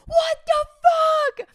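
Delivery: very high, loud retold outcry; shocked yell. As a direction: scream it out in hammed-up disbelief. Retold shock at tables puking.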